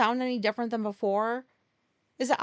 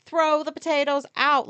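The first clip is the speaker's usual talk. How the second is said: mock order; 5 semitones higher, 7 dB louder than usual. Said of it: "I leave her notes, like".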